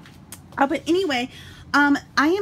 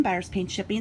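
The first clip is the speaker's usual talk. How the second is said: ordinary talk, nothing marked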